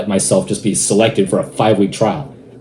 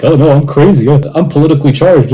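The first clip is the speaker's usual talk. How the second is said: fake crazy juror; 4 semitones higher, 5 dB louder than usual. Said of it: "They all feigned like".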